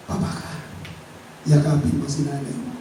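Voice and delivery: soft, quiet command; gently. Retold or imitated. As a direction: say it almost under your breath. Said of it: "mahina na". (mahina at malumanay na utos)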